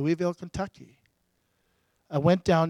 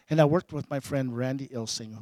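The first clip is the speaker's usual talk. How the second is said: ordinary delivery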